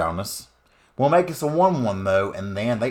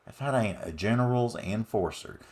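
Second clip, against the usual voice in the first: put-on Southern accent; 8 dB quieter than usual. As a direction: talk like a good ol' country boy. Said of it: "I can't do that all game".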